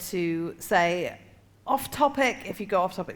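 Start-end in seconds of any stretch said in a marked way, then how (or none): 1.7-2.4 higher-pitched mock heckle; imitated heckle. Audience catching a comedian straying, imitated heckle. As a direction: call it out like a heckler. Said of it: "the audience are encouraged t".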